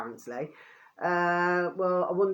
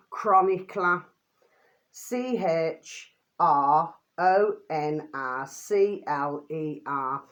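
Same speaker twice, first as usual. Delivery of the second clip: spelling slowly; much slower than usual. Shown as a demonstration. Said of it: "spelling out the name".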